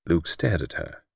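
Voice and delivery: plain reading voice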